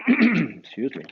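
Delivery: plain reading voice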